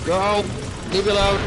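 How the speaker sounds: high, loud yell at enemies; frantic shout. Real, not acted. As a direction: shout it out in a panic. Fleeing enemies in game.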